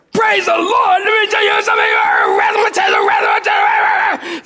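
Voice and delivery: high, incredulous drill-sergeant shouting; mock outraged yelling. Gunny exploding at the recruit's pious answer, mock fury. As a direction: throw it back, exploding into gibberish.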